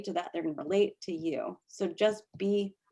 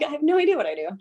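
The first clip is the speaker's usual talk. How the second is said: panicked newbie; 7 semitones higher, 5 dB louder, much faster than usual. Retold or imitated. Acting panicked new seller.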